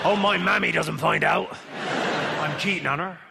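West Country pirate growl; slow hammy pirate. Shown as a demonstration. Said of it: "just a pirate voice".

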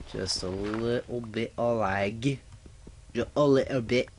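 a light mock Scottish accent; just a wee bit of Scottish, having fun; playful Scottish accent. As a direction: put on a cheeky Scottish lilt for fun. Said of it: "I'm talking in this accent".

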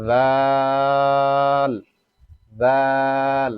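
জাল with a very long held aa; জাল drawn out for four beats; elongated vowel. Shown as a demonstration. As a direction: stretch the vowel of each জাল on one steady note. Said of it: "চার আলিফ পরিমাণ টানিয়ে পড়তে হবে".

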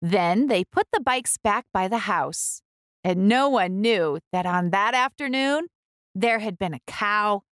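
ordinary delivery, nothing marked

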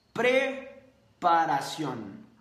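drawn out slowly, every syllable weighed; slow emphatic word. Shown as a demonstration. Coach slowly spelling out the key word for his audience. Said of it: "escucha la palabra".

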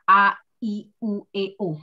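A, I, U, E, O with the mouth wide open; open-mouth vowels. Shown as a demonstration. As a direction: shape each vowel fully. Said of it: "mulutnya dibuka".

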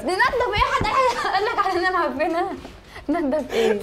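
high-pitched, half-laughing indignation; laughing protest. Real, not acted. Customer learns of prank, protests while laughing. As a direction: protest through real laughter.

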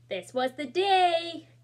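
high, loud, slow big announcement; a child's slow, booming cry of excitement; excited. A narrator's reading. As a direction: burst out with the thrill of the day. Terry wakes excited.

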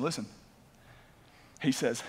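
ordinary talk, nothing marked

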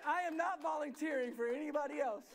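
loud, high comic protest; emphatic disclaimer. Real, not acted. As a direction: announce it firmly to the whole room. Mock-firm disclaimer to congregation.

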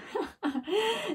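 high, soft chuckle. A narrator's reading. Ned chuckling at the stuck girl, soft. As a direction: let a giggle slip out. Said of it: "Ned couldn't help but chuckle".

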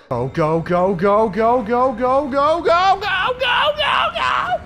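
rapid chant climbing to a very high shriek; frantic urging. Real, not acted. Player urging an escape from the chase, increasingly frantic. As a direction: keep rising in pitch until screaming.

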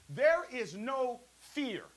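drawn out slowly, each word weighed; slow, deliberate scripture emphasis; slow emphatic reading. Acted. Preacher stretching scripture phrase.

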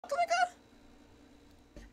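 high-pitched startled "the fuck"; cracked high yelp of disbelief; squeaky high outburst. Real, not acted. Reacting to old clip again.

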